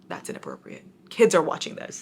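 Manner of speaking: put-on hush, kids might hear; pretend-scandalized whisper; mock hushed whisper. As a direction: whisper it like a guilty aside. Joking aside about inappropriate comment.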